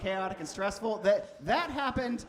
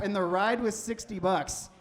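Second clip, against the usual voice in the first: usual speaking voice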